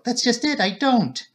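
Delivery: high, anguished cry; near wail. A narrator's reading. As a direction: let it break toward a wail. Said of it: "almost in a wail".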